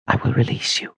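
gentle hushed whisper; soft whisper. A narrator's reading. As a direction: whisper it softly and kindly. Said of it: "she whispered softly".

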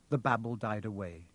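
plain reading voice

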